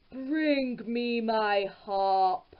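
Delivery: very slow, heavy ogre command; ogre demanding. A narrator's reading. Ogre orders his wife to fetch the harp, slow and heavy. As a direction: drag it out like a drowsy giant. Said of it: "the ogre shouted".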